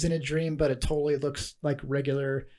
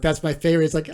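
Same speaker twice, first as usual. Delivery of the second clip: ordinary talk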